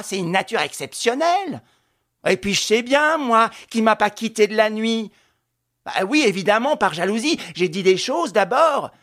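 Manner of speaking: loud, high-pitched enraged tirade; fiery revolutionary speech. A narrator's reading. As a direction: rage at the inspector in her husband's defence. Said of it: "se dépensait en révolte, en cris de rage, en discours enflammés".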